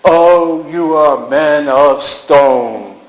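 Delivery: slow, half-sung Lear cry; Lear lament. Acted. Old dog actor playing Lear, slow and half-sung. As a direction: draw it out like Lear grieving. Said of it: "I could have been Lear".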